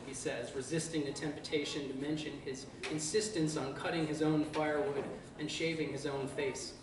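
plain reading voice

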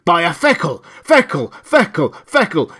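loud, high, hammering repeated cries; screamed sales pitch. A narrator's reading. Loudspeakers erupting to catch him off guard, screaming. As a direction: scream the slogan and threats relentlessly. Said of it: "It screamed".

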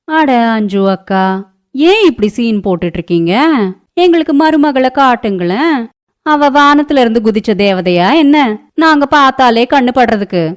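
high-pitched mocking neighbour voice; nosy neighbour, sarcastic and high; taunting neighbour.

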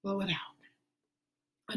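slow whispered blow it out; breathy exhale. Shown as a demonstration. Teacher guiding the class breathing, slow whisper. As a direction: breathe the words out gently. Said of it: "take three deep breaths".

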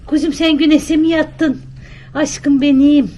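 high, slow cooing at a pet; pet talk. Real, not acted. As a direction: coo to your little darling. Talking lovingly to her pet. (evcil hayvana tiz, yavaş sevgi sesi)

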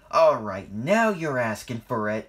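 loud, angry growl of warning; forceful, fed-up threat. A narrator's reading. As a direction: growl it like you're about to charge. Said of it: "Ernest growled".

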